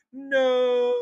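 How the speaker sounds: high, drawn-out "no"; hammed-up slow-motion wail; mock horrified cry. Host mocking the very Austin Powers poker charge, hammed-up cry.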